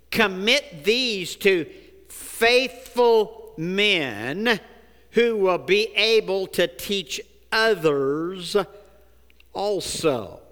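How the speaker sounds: slow, stressing each phrase; emphatic scripture reading. Shown as a demonstration. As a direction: read it word by word for weight. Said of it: "look at this".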